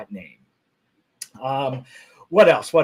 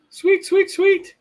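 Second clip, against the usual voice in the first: very high playful squeak; squeaky sweet; slower than usual. Acted. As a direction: chirp it in a tiny voice.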